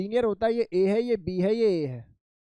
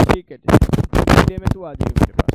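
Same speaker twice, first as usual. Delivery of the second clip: loud call across the classroom; projecting to the back of the room; 12 dB louder than usual. Real, not acted. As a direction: raise the voice to reach a far-off student. Teacher calls student from afar.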